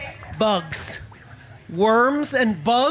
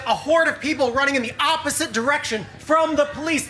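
ordinary talk

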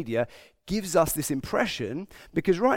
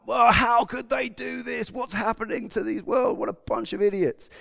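a lightly mocked moral outrage; mock indignant rant; 7 semitones higher than usual. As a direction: ham up the outraged tutting. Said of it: "you might wax lyrical".

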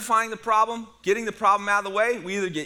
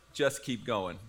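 much softer, low and laughing; pastor's calm aside; 8 semitones lower, 8 dB quieter, slower than usual. Retold or imitated. Pastor softly tells the groom to carry on, laughing. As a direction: lean in and murmur it with a chuckle. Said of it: "he looked over and he goes".

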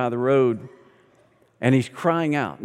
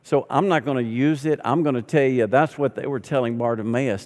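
ordinary talk, nothing marked

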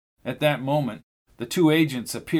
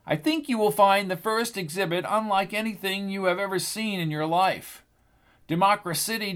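a higher, crisp announcer voice for Miss Haverhill; a lightly voiced tour guide; radio announcer clarity; 6 semitones higher than usual. A narrator's reading. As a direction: present the exhibit like on the radio. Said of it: "as clear as a radio announcer".

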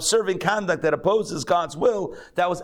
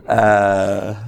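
failed Yiddish accent attempt; 6 semitones lower, 3 dB louder than usual. Real, not acted. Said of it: "I can't even channel a Yiddish accent".

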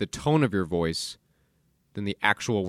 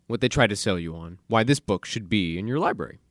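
ordinary talk

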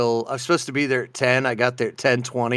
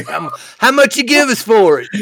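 higher and louder, half-laughing mock question; mock buyer; 8 semitones higher, 5 dB louder than usual. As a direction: play the eager customer haggling with a grin. Mocking sheriff's buyback offer.

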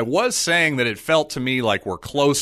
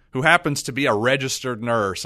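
ordinary delivery

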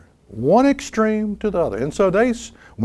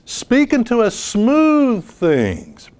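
slow scripture reading; 6 semitones higher, much slower than usual. Acted.

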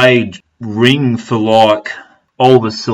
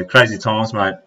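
ordinary talk, nothing marked

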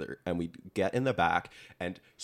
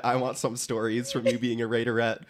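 usual speaking voice

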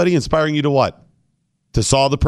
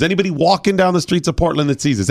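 ordinary talk

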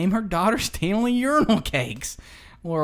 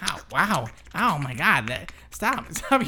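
pained cries; 4 dB louder than usual. Acted. Sudden pain on camping date.

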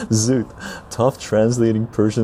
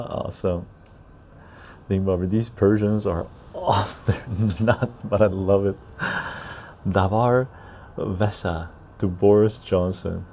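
ordinary talk

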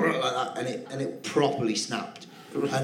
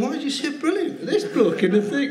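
put-on older paramedic's puzzled question, much higher and laughing; laughing through quote; 11 semitones higher than usual. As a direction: ask it baffled while cracking up. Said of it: "he's going".

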